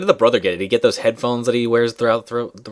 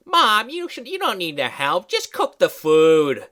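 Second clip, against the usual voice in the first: higher, patronizing order; mock bossy aunt; 8 semitones higher, slower than usual. Friend mimics the aunt ordering grandma to cook, patronizing. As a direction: talk down to grandma. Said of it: "she's like".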